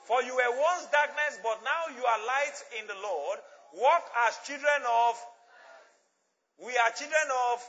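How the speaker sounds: loud group scripture reading; loud and clear. Real, not acted. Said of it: "Let's read it loud and clear".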